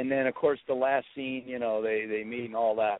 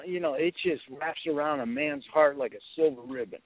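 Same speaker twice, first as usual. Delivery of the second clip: usual speaking voice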